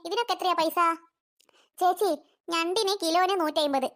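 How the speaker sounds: normal talking voice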